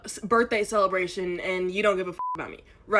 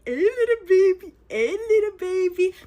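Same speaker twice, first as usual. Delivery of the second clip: put-on high, sing-song baby talk; 7 semitones higher, 3 dB louder than usual. Cooing at a baby to escape the date, high baby talk. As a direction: coo at the baby. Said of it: "I start doing this to the little baby".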